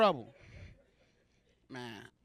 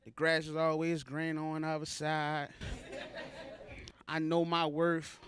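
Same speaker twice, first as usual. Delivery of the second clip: mock emotional quote; 6 semitones lower, 6 dB quieter, slower than usual. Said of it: "emotional quotes".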